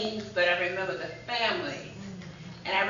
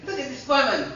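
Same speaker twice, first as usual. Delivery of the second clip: "Look at this woman" said louder and higher with a laugh; excited exclaim; 5 semitones higher, 6 dB louder than usual. Retold or imitated. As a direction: show off the wonder of it, laughing. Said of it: "he said, uh".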